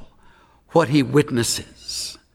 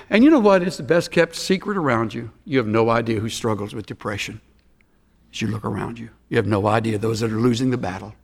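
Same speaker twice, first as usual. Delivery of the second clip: usual speaking voice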